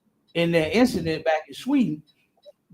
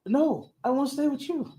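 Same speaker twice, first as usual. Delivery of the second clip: pleading woman; 7 semitones higher than usual. Retold or imitated. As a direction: plead to stay, lightly voiced woman. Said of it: "She was like".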